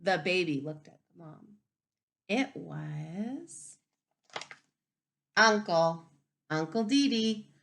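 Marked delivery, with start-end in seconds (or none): none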